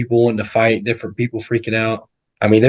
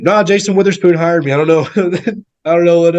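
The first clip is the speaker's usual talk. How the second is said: higher-pitched mock innocent excuse; feigned ignorance; 6 semitones higher, 3 dB louder than usual. Retelling his cover story to the lady, mock clueless. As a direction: plead clueless, half laughing, voice raised.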